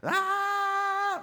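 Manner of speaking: long, high sustained scream; comic-strip wail held on one high note; cartoon scream. Shown as a demonstration. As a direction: scream like a cartoon speech bubble. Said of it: "it would be like a scream".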